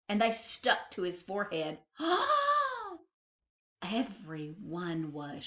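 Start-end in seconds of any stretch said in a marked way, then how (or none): 2.0-3.0 high sharp gasp; a stunned high gasp; shocked gasp. A narrator's reading. Shock after food fight.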